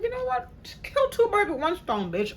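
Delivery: high, bright mock-excited squeal; excited decision. To herself, deciding to move out, high excited squeal. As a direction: pitch way up, gleeful.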